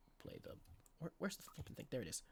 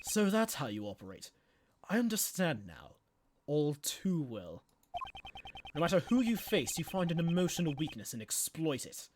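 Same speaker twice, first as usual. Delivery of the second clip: cold prosecutor; 2 semitones lower, 8 dB quieter than usual. Acted. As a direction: lay it out coldly, piece by piece. S0 voices Edgeworth.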